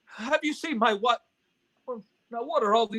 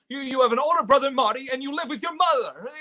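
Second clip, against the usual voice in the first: ordinary talk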